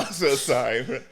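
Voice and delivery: words broken up by laughter; laughing apology. Real, not acted. Friend sorry for a dark joke, laughing. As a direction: apologize while still cracking up.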